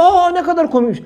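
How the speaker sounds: high, mocking imitation of a fan; mock amused listener. Preacher mimics someone praising a joke, mockingly high. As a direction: ape a giggly fan, a touch high. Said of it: "Başkası diyor".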